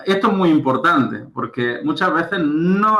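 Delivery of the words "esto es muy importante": usual speaking voice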